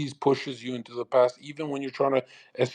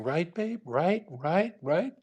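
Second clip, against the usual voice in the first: manipulative coaxing; 5 semitones higher, 5 dB quieter, slower than usual. Pretend. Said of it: "I kept saying".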